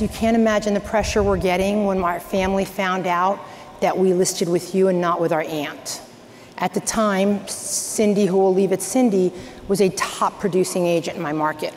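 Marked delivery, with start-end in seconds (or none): none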